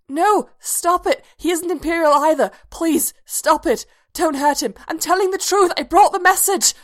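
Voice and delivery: high, loud desperate screaming pleas; terrified pleading. A narrator's reading. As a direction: beg them to stop in sheer panic. Nightmare, pleading captors to stop.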